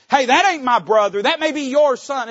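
high, loud indignant retort; resentful older brother. Retold or imitated. Older brother resenting his returned sibling, loud and indignant. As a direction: spit it out like a jealous brother. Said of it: "the older brother's saying".

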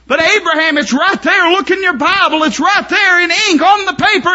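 high, loud fervent preaching; preaching at full force. Acted. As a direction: cry it from the pulpit. Preacher climaxing.

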